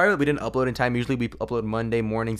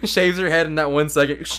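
ordinary delivery

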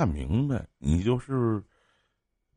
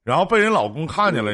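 laughing, teasing; 6 semitones higher than usual. Real, not acted. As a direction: tease him while cracking up. Host mocking caller's story.

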